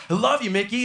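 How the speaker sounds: high, gushing fan declaration; overcome by emotion. Acted. Drunk fan tackles the mascot, overcome with love. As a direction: gush it, hammed-up and high. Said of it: "so overcome by emotion".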